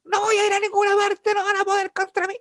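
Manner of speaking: high mock yell of defiance; retold night yelling. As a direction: pitch it up like shouting into the night. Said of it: "gritando en la noche".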